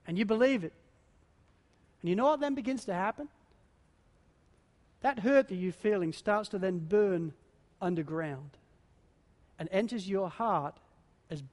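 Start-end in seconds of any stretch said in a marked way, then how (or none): none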